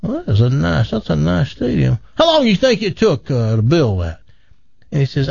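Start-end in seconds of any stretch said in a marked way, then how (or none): 0.0-4.2 high, scoffing boastful Texan; bragging Texan. Acted. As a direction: scoff and brag like a big-talking Texan. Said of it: "Texas buddy".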